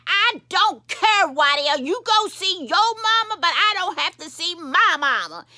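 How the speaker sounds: high, loud angry refusal; defiant anger. Acted. Daughter refusing Deacon Waddell over her mama, defiant. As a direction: dig in, stretching the opening words.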